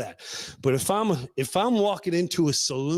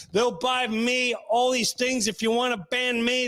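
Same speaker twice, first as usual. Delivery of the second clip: normal talking voice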